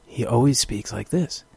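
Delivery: softly imitated creepy Doug Stamper. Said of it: "and he speaks like that".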